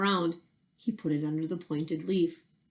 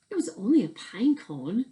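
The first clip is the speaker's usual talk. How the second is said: hush, it was nothing, breathed out; whispered; 4 semitones higher, 4 dB louder than usual. Acted. Whispered character line.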